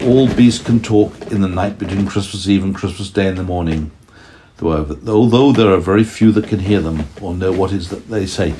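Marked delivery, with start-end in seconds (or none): none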